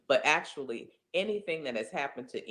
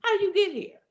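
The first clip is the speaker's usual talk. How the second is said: much higher, louder repeated question; incredulous challenge; 6 dB louder, faster than usual. Acted. Speaker challenging an imagined doubter, high and emphatic. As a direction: hit it again high, pressing the point.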